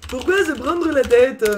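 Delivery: slow, cartoonish German accent; cliché German accent. Streamer mocks herself to chat in a cliché German accent. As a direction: drag it out in a hammed-up German accent. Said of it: "avec un accent allemand cliché".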